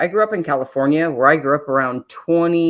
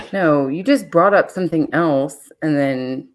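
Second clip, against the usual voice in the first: usual speaking voice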